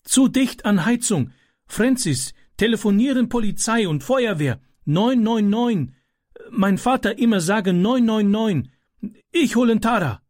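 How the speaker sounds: put-on high, urgent, foreign-accented boy; frantic boy with a foreign accent shouting orders; Ram's broken German. A narrator's reading. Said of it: "rief er".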